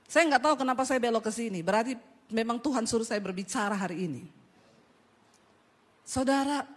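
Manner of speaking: ordinary talk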